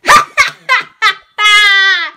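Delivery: loud villain cackle; evil laugh. Pretend. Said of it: "Evil laugh time".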